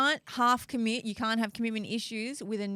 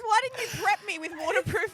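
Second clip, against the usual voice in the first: ordinary talk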